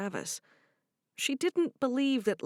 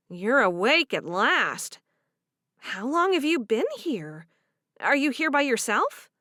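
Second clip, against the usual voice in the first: higher, lightly louder character voice; young woman; 4 semitones higher, 5 dB louder than usual. Acted. Friend finding Cherish awake at last, higher and curious. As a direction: greet her friend with gentle surprise.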